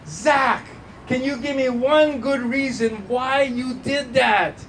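high, slow, exasperated demand; mock exasperation. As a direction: imitate a dad at his wits' end. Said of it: "I gasped in exasperation".

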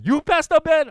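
high, loud disbelieving question; mock shock that even the pastor worries; incredulous surprise. Church folks amazed their pastor gets scared, high incredulous.

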